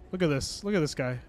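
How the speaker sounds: ordinary talk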